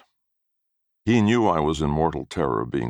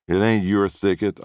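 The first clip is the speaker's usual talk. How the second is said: put-on boy talking back; 6 semitones higher, 3 dB louder than usual. A narrator's reading. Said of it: "I said".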